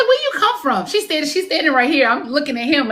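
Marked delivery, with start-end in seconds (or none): 0.0-0.9 high startled question; surprised ask. Retold or imitated. Psychic startled by a lady appearing, high surprised ask. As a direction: jump up surprised at a stranger. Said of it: "I'm like".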